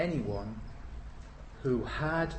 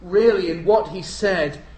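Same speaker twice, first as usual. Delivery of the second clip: loud, driving preacher; preaching at full force; 4 semitones higher, 9 dB louder than usual. Acted. Preacher stirring the congregation, loud and driving. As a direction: hammer it home to the congregation.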